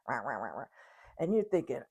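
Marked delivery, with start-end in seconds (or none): 0.1-0.7 low, softer wah-wah drone; Charlie Brown's teacher. Acted. How the nagging spouse sounds, low droning teacher imitation. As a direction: imitate a muffled adult's meaningless babble. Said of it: "Charlie Brown's teacher".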